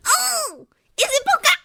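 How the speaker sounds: very high, loud excited squeal; excited squeaky voice. A narrator's reading. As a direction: squeal it like an eager imp. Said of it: "Nifty says excitedly".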